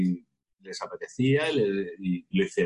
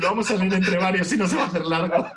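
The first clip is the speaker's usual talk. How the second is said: joking through laughter; laughing; 9 semitones higher than usual. Real, not acted. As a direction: laugh while saying it. Laughing joke about reading it together. (entre risas)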